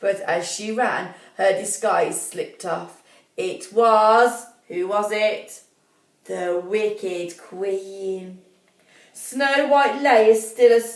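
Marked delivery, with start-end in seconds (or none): none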